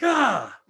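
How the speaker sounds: loud, high cry of "ah" in frustration; frustrated groan. Retold or imitated. Speaker telling the audience about hard times, frustrated outburst. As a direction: let out an exasperated "gah!". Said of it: "It was frustrating".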